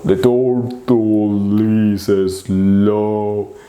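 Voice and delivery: very slow, stretched-out words; slow motion. Shown as a demonstration. Teacher showing the turtle is slow, slow motion. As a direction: drag every word out like a turtle. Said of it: "Slow motion, you see?".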